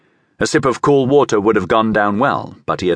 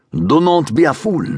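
put-on higher, accented warning; stern rebuke; 6 semitones higher than usual. A narrator's reading. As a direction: stop him with an urgent rebuke. Nahor rebukes Judas as he leaves.

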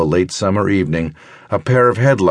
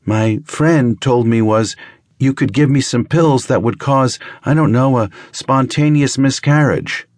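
higher, halting, searching for words; hesitant young woman; 7 semitones higher than usual. Acted. Girl telling the doctor what her friend said, halting and unsure. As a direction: pick each word carefully, unsure of yourself.

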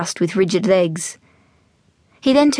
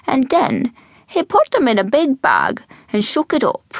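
put-on animated old man's brogue, pitch swooping; old Irish storyteller; 6 semitones higher than usual. Old man to eager young Jack, storytelling brogue. Albert tells his joke.

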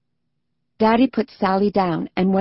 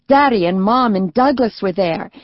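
ordinary delivery, nothing marked

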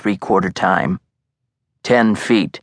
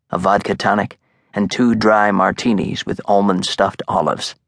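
ordinary delivery

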